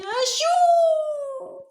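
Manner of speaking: a high, sing-song acted sneeze; sung sneeze.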